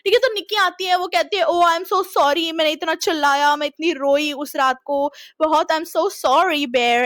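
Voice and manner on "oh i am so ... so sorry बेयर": drawn-out, slow sorry; slow apology. Retold or imitated. Girlfriend apologizing to Bear, slow and drawn out. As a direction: stretch the apology out sweetly. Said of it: "ऐसे कहती है".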